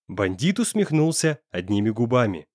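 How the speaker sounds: plain reading voice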